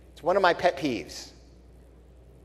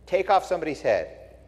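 usual speaking voice

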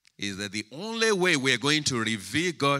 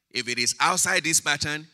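emphatic warning; 5 semitones higher, 7 dB louder than usual. Real, not acted. Preacher warning the congregation.

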